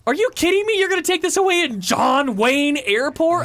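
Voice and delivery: high, outraged, punching the words "John Wayne Airport"; emphatic indignation. Retold or imitated. Traveler disputing the confiscation, emphatic and indignant. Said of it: "And I'm emphasizing now".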